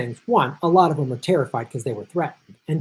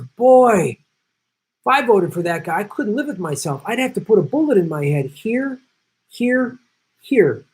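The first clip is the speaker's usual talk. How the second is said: mob style threat; 6 semitones higher than usual. Retold or imitated. Mobster threatening the union head over his vote, hammed-up menace. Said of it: "This is like a mob style threat".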